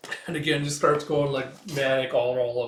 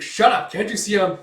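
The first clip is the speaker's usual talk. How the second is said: loud, raised, cut-off scolding; irritated retort; 7 semitones higher, 9 dB louder than usual. Retold or imitated. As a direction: snap back in annoyance. Irritated retort to Puck's shrieks.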